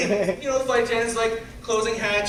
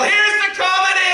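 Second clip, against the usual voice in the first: big shout; 12 semitones higher than usual. Acted. Closing the toast to the crowd, high shouted finale. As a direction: belt it out to the room.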